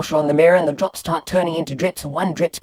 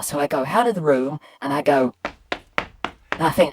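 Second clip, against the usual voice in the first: ordinary delivery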